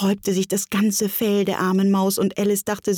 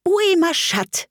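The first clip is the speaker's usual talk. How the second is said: high, raised French question; calling out; 12 semitones higher than usual. A narrator's reading. Alice tries French on the silent mouse, high and clear. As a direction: call the question out brightly pitched up.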